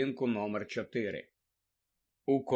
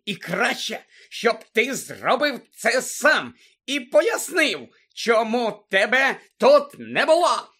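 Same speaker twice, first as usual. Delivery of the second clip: high, loud, emphatic tirade, slowing down; shrieking місіс Фік; 6 semitones higher, 6 dB louder, slower than usual. A narrator's reading. Old woman ordering lookout to confess, shrieking. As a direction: pound out each word angrily. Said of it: "верещала місіс Фік".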